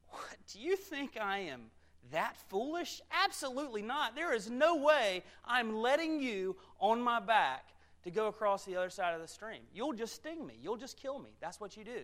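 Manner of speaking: high, emphatic refusal; indignant frog. Acted. Frog refuses to carry the scorpion across, indignant and high. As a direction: refuse outright, hammering the key words.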